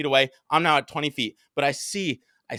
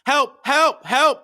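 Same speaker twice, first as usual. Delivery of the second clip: high, loud cries for help; panicked shouts for help; yelling for help; 13 semitones higher, 6 dB louder, much slower than usual. Retold or imitated. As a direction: call out desperately in the street. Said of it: "yelling help".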